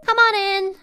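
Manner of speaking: high, loud sing-out welcome; high-pitched mock greeting; imitated doctor. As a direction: mimic a doctor calling a patient in. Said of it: "She's like".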